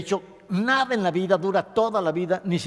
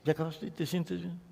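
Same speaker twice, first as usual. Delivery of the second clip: soft therapist question; 9 dB quieter than usual. Acted. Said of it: "Y dices".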